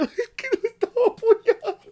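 very high sobbing with laughter; mock sobbing. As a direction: sob it out half laughing. Retelling crying at caption. (sollozo exagerado entre risas, muy agudo)

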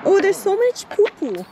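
high, loud grossed-out cry; disgusted squeal. Real, not acted. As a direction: squeal at the mess. Disgusted at the washroom.

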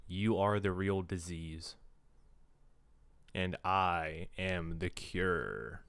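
slow, low, deliberate threat; ominous declaration. Acted. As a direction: draw out each word toward a grave finish. Menacing climactic line read out.

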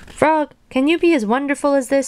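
high-pitched show-off voice; boastful Toad. A narrator's reading. Dancing Toad challenges Frog in the audience, boastful high voice. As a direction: brag in a high storybook voice. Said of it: "said Toad".

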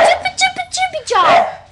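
high, loud singsong call; loud dog call. Real, not acted. Girl calling her barking dogs, high and loud. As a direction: shout for the dogs brightly.

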